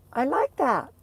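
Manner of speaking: high, loud burst of delight; sudden pleased exclamation. Real, not acted. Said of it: "excited reaction to new word".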